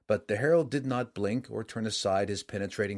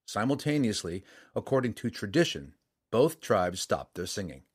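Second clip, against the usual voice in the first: usual speaking voice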